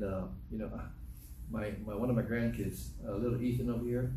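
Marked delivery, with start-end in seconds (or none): none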